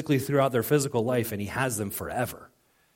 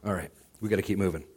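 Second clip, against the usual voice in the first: ordinary talk, nothing marked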